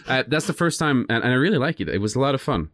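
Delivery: usual speaking voice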